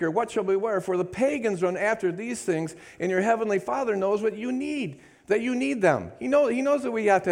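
high, impassioned preaching of the verses; high fervent sermon voice; preaching at full force. Acted. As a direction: press the promise on the crowd.

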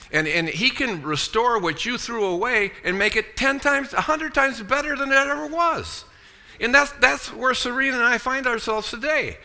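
triumphant preacher's build; preaching at full force. Real, not acted. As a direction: drive it home with rising fervour. Preaching climax about restoration.

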